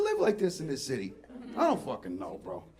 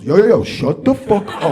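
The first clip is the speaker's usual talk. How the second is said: loud, slow warning shout; brain's inner yell; 13 dB louder than usual. Retold or imitated. Comic voicing his brain's warning after a punch, loud slow shout. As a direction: bark the warning like a street voice. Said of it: "it lights up and goes".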